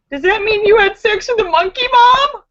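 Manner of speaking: put-on high, loud, appalled kid; child's voice. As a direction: blurt it like a shocked child. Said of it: "Awkward conversations with your children".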